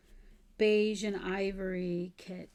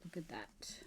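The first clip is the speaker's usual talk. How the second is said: a soft whispered look at that; awed whisper; 3 semitones lower, 15 dB quieter than usual. Real, not acted. Showing viewers the lace, in an awed whisper. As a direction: breathe it out in hushed awe. Admiring the kit pieces quietly.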